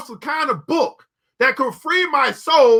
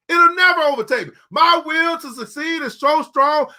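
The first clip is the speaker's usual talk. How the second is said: fervent shout; 3 semitones higher than usual. Real, not acted. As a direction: proclaim it with force. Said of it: "preaching at full force".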